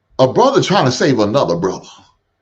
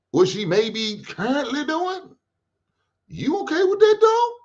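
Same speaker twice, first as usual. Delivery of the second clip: rising, squeaky, soft warning that climbs far higher; mock high whisper to a friend; 11 semitones higher, 4 dB quieter than usual. Host voicing a friend warning Jerome, squeaky and rising. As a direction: hush it to a buddy, voice climbing higher and higher. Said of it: "You hit Jerome on the hip".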